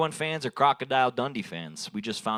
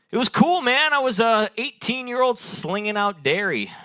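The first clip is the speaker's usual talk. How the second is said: hyped-up brag; 9 semitones higher, 5 dB louder than usual. Acted. Hyped bragging about ice cream job.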